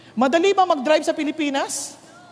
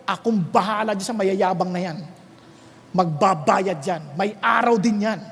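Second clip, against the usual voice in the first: much lower stern voice of God; voice of the Lord; 9 semitones lower than usual. Retold or imitated. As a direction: speak as God promising justice. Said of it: "Sabi ng Lord".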